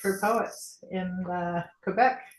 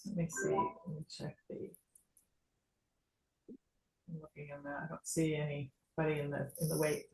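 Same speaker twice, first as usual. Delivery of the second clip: very soft, half-absent self-talk; talking to oneself; 3 semitones lower, 10 dB quieter than usual. Real, not acted. Host checking the chat and waiting room, talking softly to oneself. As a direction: think aloud under your breath.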